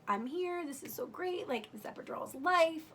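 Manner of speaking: high, elated retelling; retold elation. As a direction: gush like calling your sister with great news. Retold elated call to her sister.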